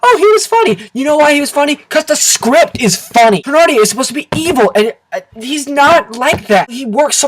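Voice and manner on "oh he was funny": mock objection. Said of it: "You say".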